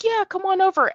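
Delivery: a star waving a fan in kindly; friendly Shatner. Retold or imitated. Shatner welcoming the fan over, high and friendly. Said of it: "He was like".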